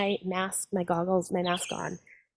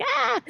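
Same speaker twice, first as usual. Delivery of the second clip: fish squeal. Retold or imitated.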